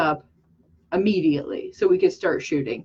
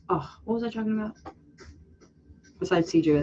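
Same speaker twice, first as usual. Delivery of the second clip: normal talking voice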